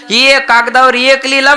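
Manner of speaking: high-pitched mock complaint; acted-out indignant husband; exasperated husband. Husband challenging wife about her note, high exasperation.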